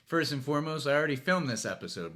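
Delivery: ordinary talk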